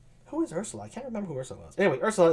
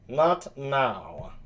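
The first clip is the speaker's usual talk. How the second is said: low, nasal character voice; lightly nasal, lowered voice; nasally Benedick; 4 semitones lower, 5 dB quieter than usual. Acted. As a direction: say it low through the nose. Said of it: "kind of a nasally sound".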